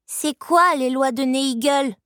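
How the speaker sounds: put-on high, slow child's question; protesting little girl. A narrator's reading. Girl asking about Neigel's laws, high drawn-out protest.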